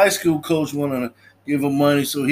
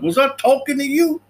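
high, louder mock snap; sharp retort; 10 semitones higher, 4 dB louder than usual. Father snapping at his son, mock shout. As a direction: bite back at the kid. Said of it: "He's like".

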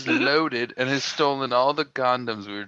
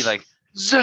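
high whooshing zing; zing sound effect; 11 semitones higher, 4 dB louder than usual. Retold or imitated. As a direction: zip past with a zing. Imitating sprinters passing.

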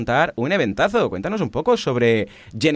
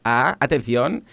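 ordinary talk

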